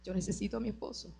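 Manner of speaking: soft, quick private plea; hushed, mildly pleading retelling; retold tearful prayer. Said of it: "llorando, le decía".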